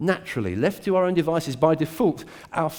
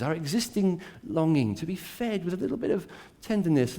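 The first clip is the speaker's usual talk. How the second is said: ordinary talk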